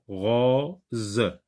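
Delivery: rose as a drawn-out ro, then ze; rose in two syllables. Shown as a demonstration. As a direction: break rose between ro and ze. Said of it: "je sectionne le mot en deux syllabes".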